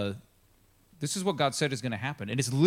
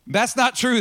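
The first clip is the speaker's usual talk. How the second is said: loud, higher-pitched retort; acted protest; 9 semitones higher, 7 dB louder, slower than usual. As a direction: call out a challenge, voice raised. Officers heatedly challenge Jehu's evasion.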